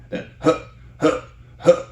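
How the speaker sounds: sharp unvoiced belly huffs; core grunts. Shown as a demonstration. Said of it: "all of the power is coming from the core".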